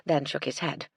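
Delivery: ordinary delivery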